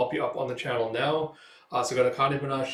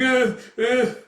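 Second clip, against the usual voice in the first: high, loud scared stutter; frightened stammer; 11 semitones higher, 8 dB louder than usual. Acted. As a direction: choke on the words in fear. Thug stammers in fear.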